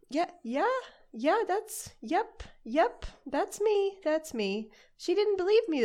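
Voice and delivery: high and loud, eager; bursting with eager delight; excited. Acted. Character excited answer.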